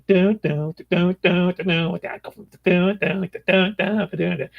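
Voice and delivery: lightly scat a boogie bass line; lightly mimicking a blues guitar boogie; hummed guitar riff. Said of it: "into a big [name] thing".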